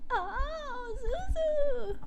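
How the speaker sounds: very high, soft cooing. Real, not acted. Cooing at the baby.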